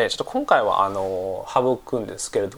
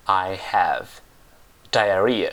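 "I have diarrhea" slowly, with a long gap before "diarrhea"; slow word-by-word model; 3 semitones lower, much slower than usual. Shown as a demonstration. Teacher repeats a hard English word for learners, slow separated "I have diarrhea". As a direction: set "diarrhea" apart after a slow "I have".

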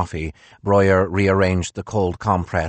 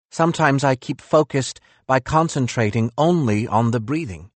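higher, bitter philosopher voice; reproachful, raised-pitch Nietzsche; 5 semitones higher than usual. Acted.

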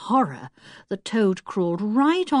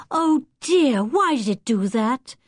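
disappointed; 3 semitones higher than usual. A narrator's reading. Anne as the rabbit flees from her, disappointed. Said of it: "said Anne, disappointed".

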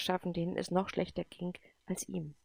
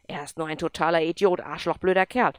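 louder, spitting out the insults; angry outburst; 8 dB louder than usual. Acted. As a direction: vent the rage in a burst. Boy swears about Andrew. (wütend und laut schimpfend)

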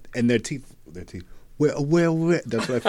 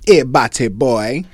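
put-on Scottish accent; 11 dB louder, much slower than usual. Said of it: "you got any in the arsenal".

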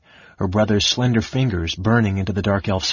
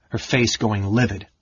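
plain reading voice

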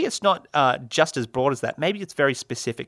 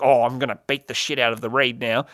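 angry mum; 2 semitones lower than usual. Retold or imitated. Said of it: "your mum's listening in the background, be like".

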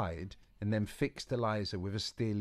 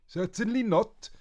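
put-on higher, louder old witch voice; Boris; 13 semitones higher, 8 dB louder than usual. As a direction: snap back with dignity.

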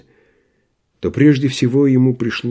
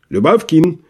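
higher, abrupt call-out; sudden loud call; 6 semitones higher than usual. A narrator's reading. Postmaster summoning his clerk, sudden shout. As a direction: call out sharply to a subordinate. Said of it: "вдруг крикнул он так громко".

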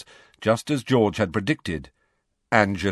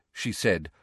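ordinary delivery, nothing marked

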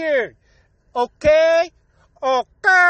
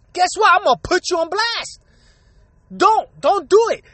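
higher, pushed and angry; rising fury at braggers; heated rant; 6 semitones higher than usual. Real, not acted. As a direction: call them out, voice climbing higher.

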